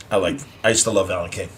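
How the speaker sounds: normal talking voice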